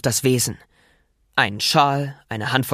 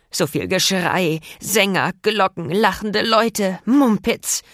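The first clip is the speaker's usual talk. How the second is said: slow, rising higher with each word; disgusted rant; 6 semitones higher, slower than usual. Acted. Venom ranting about noise.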